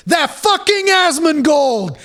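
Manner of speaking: high, slow shouting blame; mock outraged shout. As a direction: yell at Asmongold like a sore dev. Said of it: "what you have to say after that".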